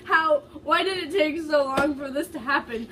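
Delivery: half-laughing, pushed up high; laughing through it. Real, not acted. Reader losing it to laughter over the fanfic, laughing. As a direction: read it while cracking up.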